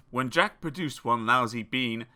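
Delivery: usual speaking voice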